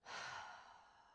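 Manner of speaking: long, heavy open-mouthed sigh; dramatic sigh. Shown as a demonstration. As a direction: really let the sigh go. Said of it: "a nice dramatic sigh feels really good".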